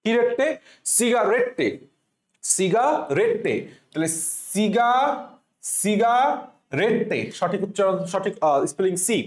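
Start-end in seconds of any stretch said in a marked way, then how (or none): 0.9-3.6 "সিগারেটে" as siga-ret-te, second one drawn out; spelling pronunciation. Shown as a demonstration. Teacher drills the spelling, "সিগারেটে" with sounded final te. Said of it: "এখন উচ্চারণ করবো হচ্ছে সিগারেটে". 4.5-7.2 "সিগা", pause, then slow "সিগারেটে"; broken spelling pronunciation. Shown as a demonstration. Teacher breaks word down, "সিগারেটে" slowly in chunks. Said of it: "এখন উচ্চারণ করবো হচ্ছে সিগারেটে".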